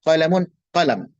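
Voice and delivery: "Qalamun" then "qalam" with the mim closed; ending dropped. Shown as a demonstration. Teacher contrasting full and closed forms. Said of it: "Mimnya disukunkan".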